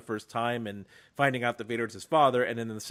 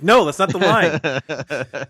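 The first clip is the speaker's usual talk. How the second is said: exasperated; 5 semitones higher, 8 dB louder, much slower than usual. Acted. Annoyed by people's misquotes.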